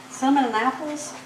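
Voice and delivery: normal talking voice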